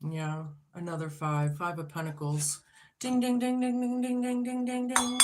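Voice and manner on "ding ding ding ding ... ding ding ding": high chanted "ding, ding, ding" like a prize bell; bright sing-song bell chant. Real, not acted. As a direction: ring it out like a game-show bell. Cards confirm, bell imitation.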